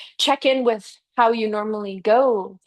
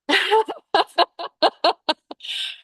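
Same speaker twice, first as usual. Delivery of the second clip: laugh; 10 semitones higher than usual. Real, not acted. Laughs at body's complaint.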